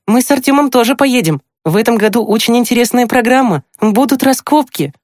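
put-on very high, animated girl's voice; excited exclaiming. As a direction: share news excitedly in a high voice. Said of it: "воскликнула Маша". (возбуждённо, очень высоким девичьим голосом)

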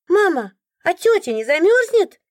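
put-on a child innocently asking mum; curious child. A narrator's reading. Little boy asking his mother about the stranger, high child voice. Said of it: "задал резонный вопрос ребёнок".

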